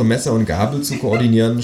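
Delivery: plain reading voice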